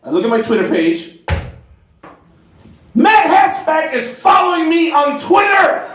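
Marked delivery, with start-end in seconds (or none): 2.9-5.8 high, loud shout of joy; ecstatic shout. Retold or imitated. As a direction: yell it like you just won. Excited shout of triumph.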